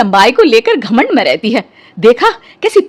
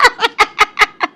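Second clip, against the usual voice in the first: high mocking laugh; 11 semitones higher than usual. Gossiping neighbour laughs.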